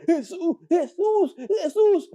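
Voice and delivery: preacher imitating a healed mute straining to say the name; mute person speaking.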